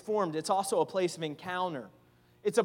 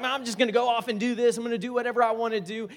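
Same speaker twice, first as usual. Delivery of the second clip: fast, higher, carefree rush; mock defiance; 4 semitones higher, 5 dB louder, much faster than usual. Wayward believer decides to go off alone, hurried mock defiance. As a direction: rattle it off quickly, brushing everyone aside. Acts out a wilful wanderer.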